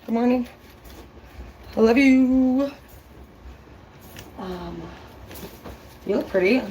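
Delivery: put-on high, sing-song baby talk; cutesy sweet talk. Greeting Google every morning in a high cutesy coo. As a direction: coo at it like a lover.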